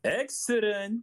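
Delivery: high, drawn-out "excellent"; stretched high-pitched impression; character catchphrase. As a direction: mimic a game character's catchphrase, slow and high. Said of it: "catchphrases for some of the characters".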